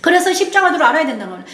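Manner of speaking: normal talking voice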